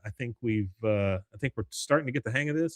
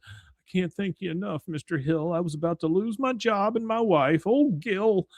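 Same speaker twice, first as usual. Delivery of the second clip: high, pitiful salesman act; hammed-up grateful sad sack, voice raised; Gil; 8 semitones higher than usual. Disheveled guy selling to Hank, high pleading act. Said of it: "completely turns that character off".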